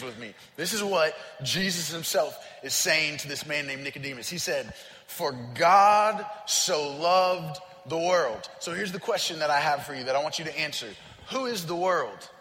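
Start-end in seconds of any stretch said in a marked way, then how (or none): 5.1-8.5 slow scripture reading. Acted. Quoting Jesus' words to Nicodemus.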